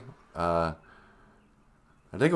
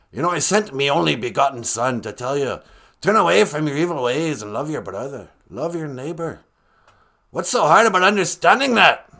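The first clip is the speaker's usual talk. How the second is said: exasperated pirate preacher, raised voice; Gitche Manadu; 4 semitones higher, 5 dB louder than usual. Acted. Creator scolding mankind over loving neighbors, gruff pirate voice. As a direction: preach like a gruff pirate, building to a loud finish. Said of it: "kinda sounds like a pirate".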